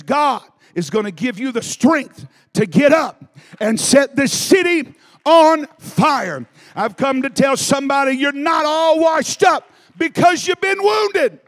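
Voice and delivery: loud, high, shouted climax; preaching shout. Real, not acted. Preacher shouting hope to the wounded congregation. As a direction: shout the good news with full force.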